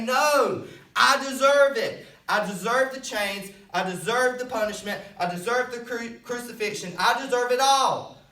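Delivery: high, loud cry of guilt; impassioned protest. Acted. Sinner refusing to have his chains removed, impassioned shouting. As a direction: shout it out, insisting on punishment. Acts own anguished refusal of freedom.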